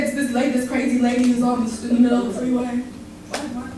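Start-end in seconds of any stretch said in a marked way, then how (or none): none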